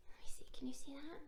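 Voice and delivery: soft, fast unvoiced whisper. Reader whispering to the children while showing the page, hushed. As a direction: hush it quickly to the kids. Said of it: "reader whispers to children".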